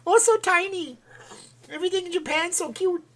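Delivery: very high falsetto, mock Japanese girl; hammed-up cutesy Japanese girl. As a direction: coo in a put-on Japanese girl squeak. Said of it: "like, a Japanese girl".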